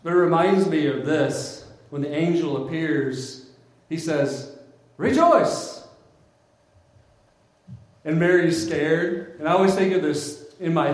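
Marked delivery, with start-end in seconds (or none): 5.0-5.9 sudden high, loud exclamation; booming surprise greeting, pitched way up; startling angelic shout. Acted. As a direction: burst out like an angel appearing from nowhere.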